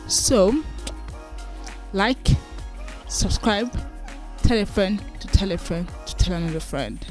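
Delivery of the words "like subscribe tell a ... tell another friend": ordinary talk, nothing marked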